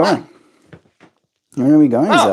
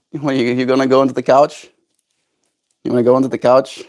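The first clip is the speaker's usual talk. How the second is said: ordinary talk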